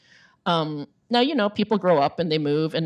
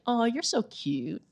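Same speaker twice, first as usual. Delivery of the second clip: put-on cooing at a child; 6 semitones higher, 5 dB quieter, slower than usual.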